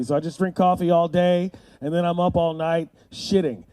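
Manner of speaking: normal talking voice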